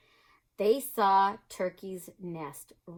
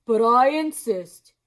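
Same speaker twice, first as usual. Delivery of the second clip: slow, loud, friendly moose; 3 semitones higher, 9 dB louder than usual. Acted. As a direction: draw it out slowly and loudly. Mister Moose voice.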